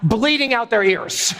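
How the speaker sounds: ordinary delivery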